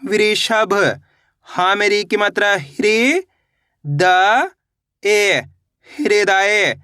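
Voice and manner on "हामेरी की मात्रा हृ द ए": very slow, each syllable drawn apart. Shown as a demonstration. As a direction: spell it out slowly, piece by piece. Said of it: "कैसे पढ़ेंगे?". (अक्षर-अक्षर धीरे-धीरे)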